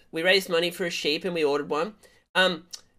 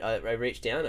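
ordinary talk, nothing marked

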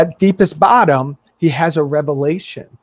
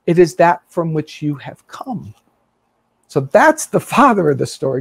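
usual speaking voice

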